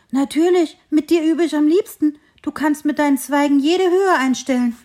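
high, loud, eager insistence; eager reassurance. Acted. Hare assuring the tree he loves practising there, excited and loud. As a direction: gush that of course he loves it.

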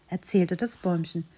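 plain reading voice

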